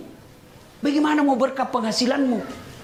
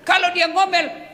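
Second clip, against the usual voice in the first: shouting; 7 semitones higher than usual. Real, not acted. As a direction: call it out to the husbands. Preacher shouting at husbands.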